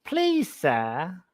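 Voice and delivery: lightly voiced Cockney urchin begging for a shilling; a hint of a Victorian Cockney errand boy pleading; Cockney delivery boy. Cockney delivery boy begging the lady for a shilling, light pleading. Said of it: "wild impressions of, uh, of delivery boys".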